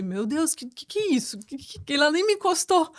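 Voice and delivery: incredulous mock outrage, stammering on "que"; indignação recontada. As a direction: stumble into indignant disbelief, pitch climbing. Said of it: "eu fiquei tão indignada". (indignação encenada, gaguejando de incredulidade)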